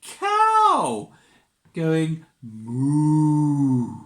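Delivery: loud lowing moo; cow moo. Shown as a demonstration. Reading to a child, mooing like a cow. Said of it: "Can you go moo with me?".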